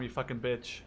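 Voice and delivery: ordinary talk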